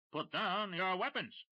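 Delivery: high, slow odd-sounding shout. Acted. Odd voice echoing Dash's order to the smugglers. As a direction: repeat the order in a strange slow voice. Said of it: "odd-sounding voice shouted".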